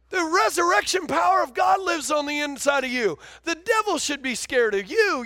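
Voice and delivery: fiery preacher proclaiming power; preaching shout. Acted. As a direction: proclaim it to the whole church. Preaching at full force.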